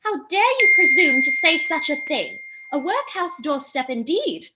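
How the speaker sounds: loud, high, offended retort; haughty indignation. Acted. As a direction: rebuke them for the insult. Girl offended by the clerk.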